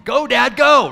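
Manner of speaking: high, loud shouted urging; kids urging dad. Retold or imitated. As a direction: yell it like excited kids in the back seat. Kids yelling to texting dad.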